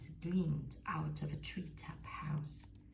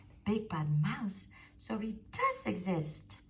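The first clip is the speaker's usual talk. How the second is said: alarmed cry; 5 semitones higher, 6 dB louder than usual. Acted. Child's alarm.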